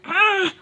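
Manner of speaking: high mock-scream "ugh"; short high-pitched burst; retold outburst. Teller mimicking her own freak-out with some pride, mock scream. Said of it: "you really were like".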